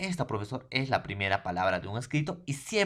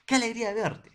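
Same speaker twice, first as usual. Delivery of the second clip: much higher and louder, thrilled to meet; delighted exclamation; 11 semitones higher, 6 dB louder, faster than usual. Shown as a demonstration. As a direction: cry it out like meeting an old friend. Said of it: "Hace mucho tiempo que no sé nada de ti".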